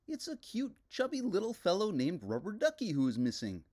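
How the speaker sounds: sad little puppet on his birthday; Ernie voice, a touch sad. A narrator's reading. Ernie sadly explaining his lost toy to the detective.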